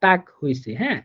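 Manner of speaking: ordinary delivery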